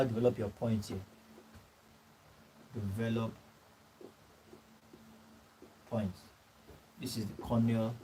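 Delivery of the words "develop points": softer, each word alone with pauses; dictating slowly. Real, not acted. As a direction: say it so they can copy it. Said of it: "dictating notes to the class".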